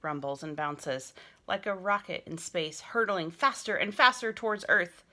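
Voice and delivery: lively picture-book narration; story narration. A narrator's reading. Teacher reading a picture book to her class, lively narration. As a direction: read it like an exciting story to children.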